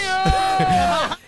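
shrill laughing squeal; high burst of laughter. Real, not acted. Laughing mid-story.

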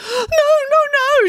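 loud, high frantic refusal; shrieked protest; panicked protest. A narrator's reading. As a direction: cry it out in rising panic. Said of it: "she protested".

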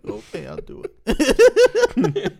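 mock sheepish giving in. Imitating a shamed friend giving in.

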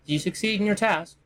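high-pitched questioning figure; questioning in character. Acted. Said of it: "she's like".

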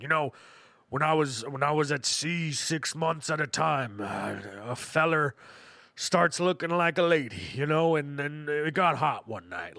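lightly voiced old navy grandpa; a hint of an old sailor drawling.

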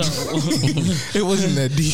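slow, high words through a real laugh. Real, not acted. Host laughing off his co-hosts' reading of the topic. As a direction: laugh while brushing it off.

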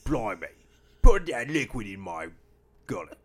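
loud hammed-up Cockney accent. A husband riffing on the sink ad in a loud Cockney accent. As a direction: belt it out like an old London pub regular. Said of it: "like, Cockney accent".

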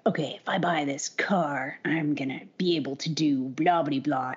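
low, hushed cool-guy voice. Acted. Car buyer telling themself what they'll do, low cool voice. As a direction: drop it low and soft, playing cool. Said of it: "I'm talking in that voice; that's what my cool voice is".

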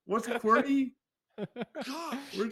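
put-on high, confused old man voice. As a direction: fumble like a lost grandpa.

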